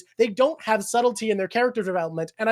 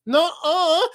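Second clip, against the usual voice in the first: higher-pitched childlike denial, acted; playful, raised-pitch imitation of denial; mock refusal; 7 semitones higher, 4 dB louder than usual. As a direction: refuse it like a stubborn character. Voices Zoro's refusal in retelling.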